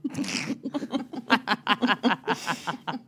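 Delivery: long soft laugh. Real, not acted. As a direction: keep laughing.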